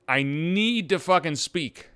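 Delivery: ordinary talk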